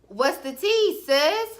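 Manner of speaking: high, sassy gossip tone. Retold or imitated. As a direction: ask it like spilling tea with a friend. Sassy gossip question to listener.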